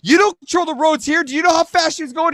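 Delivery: high-pitched argumentative retort; retold angry challenge. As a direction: re-enact challenging the neighbor. Acting out his side of quarrel.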